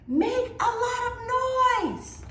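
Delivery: very high, loud, drawn-out call; rally the whole village; yelling. Acted. Mai yells to the villagers to make noise, very high and loud. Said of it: "She yelled to the other villagers".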